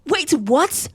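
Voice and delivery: loud, high rising question; shocked disbelief. Acted. Girlfriend learning it is only shoelaces, shocked disbelief. Realises it was no proposal.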